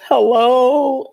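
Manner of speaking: high, shaky answer of a friend; upset hello. Retold or imitated. Friend answering the phone upset. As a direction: pick up the phone already upset.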